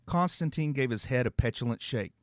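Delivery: plain reading voice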